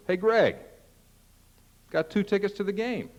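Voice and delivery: high-pitched call across the yard; neighbor calling over. Acted. Neighbor hails a guy outside about game tickets, calling over high. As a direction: call out to the guy next door, pitched well up. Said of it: "a neighbor calls over".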